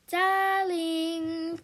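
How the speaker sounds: hammed-up posh English accent, drawn out; fake English accent. A narrator's reading. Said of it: "in the fake English accent she sometimes likes to do".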